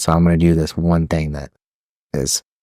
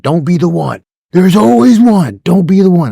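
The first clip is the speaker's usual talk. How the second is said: higher, louder, slow DI warning; hammed-up drill sergeant bark; drill instructor warning; 9 semitones higher, 5 dB louder than usual. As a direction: drill it into the recruits.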